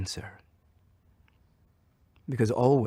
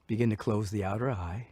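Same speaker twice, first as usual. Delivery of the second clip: ordinary talk, nothing marked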